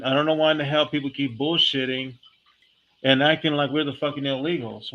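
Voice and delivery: usual speaking voice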